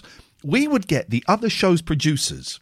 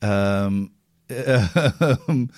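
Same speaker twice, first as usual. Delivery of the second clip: ordinary talk, nothing marked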